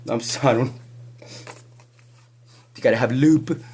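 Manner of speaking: normal talking voice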